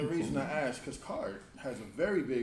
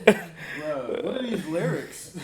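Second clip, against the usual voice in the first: incredulous; 5 semitones higher, 11 dB louder, much slower than usual. Real, not acted. Laughing disbelief at song lyrics.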